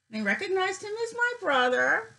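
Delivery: pitched high with thrilled disbelief; light, delighted announcement; excited. Real, not acted. Sister thrilled the site matched her brother, voice up high. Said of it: "it's so exciting".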